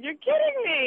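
high-pitched disbelief; incredulous. Acted. Caller reacting to the guest's moaning, incredulous. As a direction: exclaim it, pitch leaping up.

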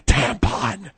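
sudden booming blurt; shouting. Acted. Host mockingly betrays Margaret's whispered secret, shouting. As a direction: bellow the secret word.

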